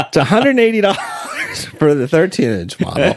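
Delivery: laughing through the price; can't get through it for laughing. Real, not acted. Host reading the sleeve price to his cohost, laughing.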